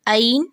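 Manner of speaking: عین said with a hamza glottal stop; hamza mistake. Shown as a demonstration. Teacher showing the hamza error. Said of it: "عین پڑھ رہے ہوتے ہیں حمزہ کے ساتھ".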